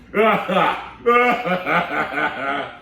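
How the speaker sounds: long loud belly laugh; elephant laughing. Acted. Elephant shakes from head to tail, a long loud belly laugh. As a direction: shake with a big rolling laugh. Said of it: "The elephant shook from his head to his tail".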